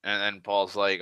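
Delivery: plain reading voice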